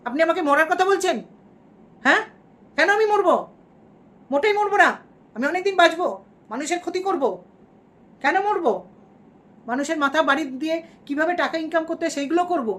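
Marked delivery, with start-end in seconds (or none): 0.1-2.2 higher, louder indignant snap; angry outburst. Pretend. Angry at a commenter. 2.8-8.7 much higher, louder defiant rant; angry outburst. Pretend. Angry at a commenter.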